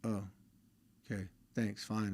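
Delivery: lightly mocking a teen's indifferent thanks; mumbled brush-off. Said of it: "They just sat there and like".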